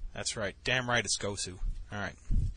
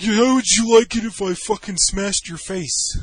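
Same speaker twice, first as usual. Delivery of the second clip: high, loud, drawn-out mock menace; imitated angry challenge; drunken threat; 7 semitones higher, 7 dB louder, slower than usual. Acted drunk friend threatening violence.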